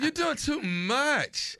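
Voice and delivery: very slow, leaning on doing; slow emphatic complaint. Retold or imitated. The young guy telling her off, slow and weary. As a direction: drag out each word.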